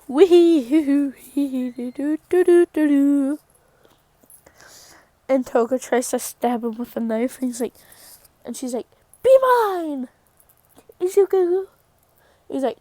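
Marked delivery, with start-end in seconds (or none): 0.1-3.4 whee-hoo followed by doo-doo babble; mock carefree vocal noises; playful nonsense sing-song. Spelt playful noise while sitting. 9.2-11.7 much higher put-on voice; high, swooping mock love confession; acted lovestruck plea.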